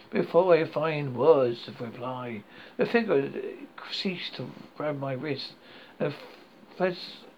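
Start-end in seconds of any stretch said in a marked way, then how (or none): none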